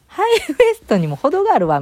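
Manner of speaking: mocking the shirt's absurdly high waist, loud and high; mock complaint. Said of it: "みたいな".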